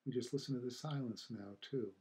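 unhurried meditation guide, long silences between phrases; guided meditation. Acted. Teacher guiding listeners to feel the silence after the chime, calm meditation voice. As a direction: ease listeners into stillness, leaving space after each phrase.

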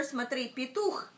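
plain reading voice

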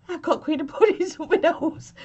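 giggling confession; real laughter slipping into the words; laughing. Real, not acted. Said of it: "I really tried to stay composed".

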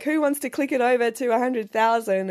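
ordinary talk, nothing marked

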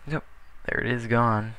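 ordinary delivery

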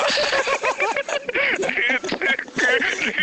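squealing burst of laughter; high-pitched laugh. Real, not acted. High laugh at own pun.

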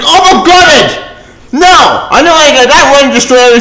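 slow, high mock gasp of dismay; mock fan gasp. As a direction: ham up a shocked fan. Mocking a fan's reaction.

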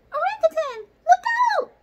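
very high, loud squeaky warning; a mouse's shrill alarm; squeaking mouse. A narrator's reading. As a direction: squeak it out like a tiny panicked mouse. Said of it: "squeaks the mouse".

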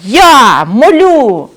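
гнівно, вище й гучніше, повільно; загрожуй, мов стара велетенська споруда; розлючений млин. Acted. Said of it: "заскрипів він сильною силою". (млин лютує на розбійників)

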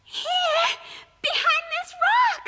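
put-on trapped girl crying out for help. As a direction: call out desperately from a trap. Trapped, calling back.

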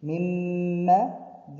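مما with the mim stretched long; مما with a slow humming mim; held ghunna. Shown as a demonstration. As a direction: hold the nasal mim. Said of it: "mim with shadda".

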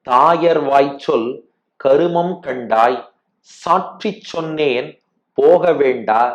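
slow, measured recital, phrase by phrase; verse recitation. Acted. Teacher reciting the fifth pasuram to listeners, slow devotional recitation. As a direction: draw out each phrase of the sacred verse with pauses.

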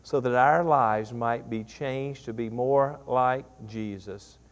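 soft, low prayer voice trailing into amen; closing prayer. Acted. Pastor closing prayer to the church, soft and low. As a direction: pray quietly before the congregation.

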